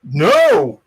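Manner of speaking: high, loud startled cry; shocked shout. Real, not acted. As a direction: yelp it out in alarm. Reacting to choking.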